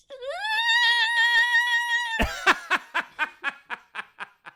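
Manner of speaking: very high, loud llama bleat; shrill-pitched animal cry breaking into stutters; llama noise. Pretend. Guest demonstrating llama noises for the host, hammed-up bleat. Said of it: "you do a llama".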